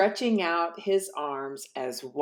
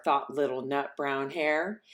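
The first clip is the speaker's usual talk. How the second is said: ordinary delivery, nothing marked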